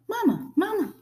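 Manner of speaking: put-on high, tiny baby-bird voice; little high-pitched chick calling out; peeping gosling. A narrator's reading. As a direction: peep it like a newly hatched gosling. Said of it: "peeped the gosling".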